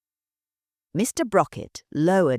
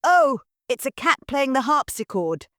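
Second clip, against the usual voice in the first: lightly voiced cheerful girl, pitched higher; chirpy young daughter answering without a care; bright girl; 8 semitones higher, 4 dB louder than usual. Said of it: "Marianne replied brightly".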